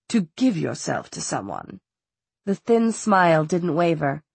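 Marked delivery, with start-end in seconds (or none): 0.1-1.8 low, quiet, composed villain; calm menace, lower and softer; cool villain voice. A narrator's reading. Valentine voiced by narrator.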